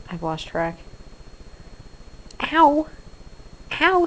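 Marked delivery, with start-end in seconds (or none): 2.5-4.0 ow. Real, not acted. Pet bites hand.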